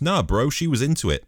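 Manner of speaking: a lightly voiced bro shrugging it off; mock bro excuse. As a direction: toss it off like a clueless bro. Mocking a lame excuse.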